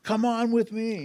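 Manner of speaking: high, put-on imitation of a bandleader's invitation. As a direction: call a friend along in another man's voice. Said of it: "and he said".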